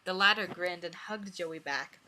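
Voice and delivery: ordinary delivery, nothing marked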